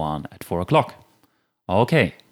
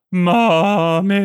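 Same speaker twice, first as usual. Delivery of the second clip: put-on high whiny wail of a sleepy child; a child calling for mommy, sad and sleepy; tired sad toddler; 9 semitones higher, 5 dB louder than usual. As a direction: whimper like an exhausted three-year-old. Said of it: "a tired three-year-old who's just very sad".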